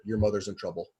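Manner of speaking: low, hushed warning. Acted. Doc quietly warns the drunk brothers, hushed. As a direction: drop pitch and volume, keep it secret. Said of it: "I'm saying this very hushed".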